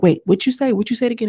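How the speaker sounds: mock a mom daring a repeat; challenging mom. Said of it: "it almost, like, challenges them".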